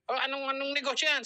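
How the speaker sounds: high-pitched mock indignant question; imitating an irritated challenge; mock angry. Angry boyfriend questions the business, mock angry. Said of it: "Nagalit, nag-reply ulit".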